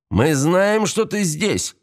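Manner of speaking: high-pitched taunt to someone hiding; menacing call. Acted. Бандит кричит спрятавшейся девушке.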